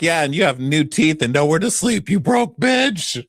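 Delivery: sneering diva dig; mock insult. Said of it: "Angela's like".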